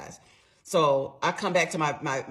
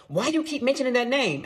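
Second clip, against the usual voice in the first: higher, louder mock bluster; acting all bad; 8 semitones higher, 5 dB louder than usual. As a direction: imitate a husband storming in acting tough. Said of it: "all Billy Bad".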